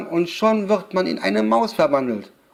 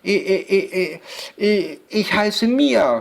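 put-on nervous child stammering on the first sound; stuttering; 4 semitones higher than usual. A narrator's reading. Girl giving the witch her name, stuttering nervously. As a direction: stutter repeatedly into a high answer. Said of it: "stotterte Mia".

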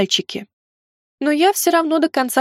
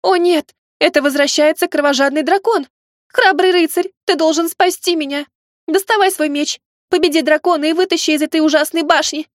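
squeaky shout; 6 semitones higher than usual. Pretend. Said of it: "Кричу писклявым голосом".

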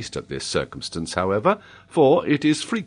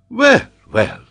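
loud, high exclamation; taken aback; 8 dB louder than usual. A narrator's reading. Geographer is taken aback by the boy, loud amazement. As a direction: react in amazed disapproval.

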